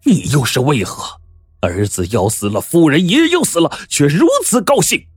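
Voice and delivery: high, scolding outburst of an outraged official; furious rebuke. Acted. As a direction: shout him down in disbelief. Prefect berates Zhang in fury.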